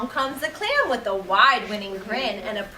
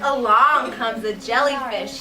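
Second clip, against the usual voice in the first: ordinary delivery, nothing marked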